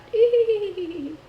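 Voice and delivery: put-on high, loud giggle of a little child; eerie child giggle. Recalling the horror-movie laugh in the lobby, high child giggle. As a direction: mimic the repeated creepy kid laugh.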